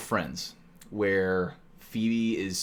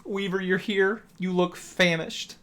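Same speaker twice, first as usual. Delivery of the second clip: mock gracious server; 7 semitones higher, slower than usual.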